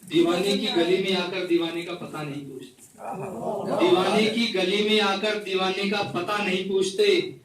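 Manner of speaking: measured reciting of a couplet; poetry recital. Acted. Poet reciting a couplet to the gathering, measured recital. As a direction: recite it like at a mushaira.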